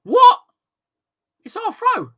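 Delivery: high, loud what of disbelief; outraged disbelief. Real, not acted. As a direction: protest at the ref's call in shock. Disputing a throw-in decision.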